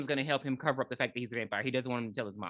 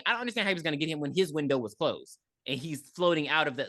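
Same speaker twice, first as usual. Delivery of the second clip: ordinary talk, nothing marked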